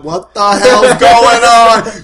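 big, slow, drawn-out exclamation; loud, high, stretched-out outburst; loud bewildered yell. Acted. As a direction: bellow it in hammed-up disbelief. Malone quoted, yelling in confusion.

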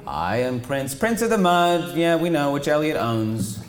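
high, loud mock announcement; mocking royal sneer; mocking title.

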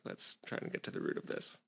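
unvoiced mocking whisper; whispered mock quote. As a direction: breathe it out, mocking.

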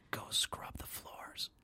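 whispered, slow wicked stepmother. Pretend. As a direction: hiss orders like a cruel stepmother. Stepmother ordering Cinderella to scrub.

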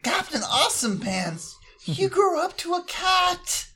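very high, sing-song praise; high cooing voice. Acted. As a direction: coo at the grown cat. Player voices cooing at the cat.

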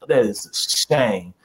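drawn-out mock pity; mock disapproval. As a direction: imitate a grave, disappointed listener. Acts out disapproving audience, contrasted.

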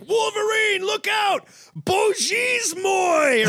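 hammed-up Russian accent, high shout; high, drawn-out cartoon Russian cry; Colossus. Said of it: "yeah, like Colossus".

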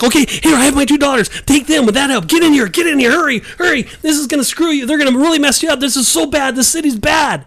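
panicking. Acted. Host plays Lot offering his daughters to the mob, frantic panic. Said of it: "I think he's just panicking".

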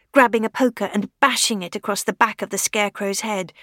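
usual speaking voice

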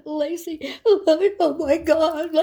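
high, loud mock wail; drawn-out, hammed-up sobbing on the phone; hysterical weeping. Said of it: "she is weeping. She is crying".

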